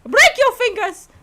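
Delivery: high, loud courtroom cry; shouted objection. Acted. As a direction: yell it across the court. Voicing a game objection line.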